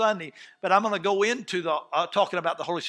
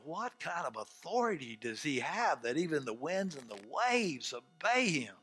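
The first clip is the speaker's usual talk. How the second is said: hushed awe; 5 semitones lower, 11 dB quieter than usual. Acted. Disciples after the storm obeys, hushed in awe. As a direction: ask it quietly, amazed.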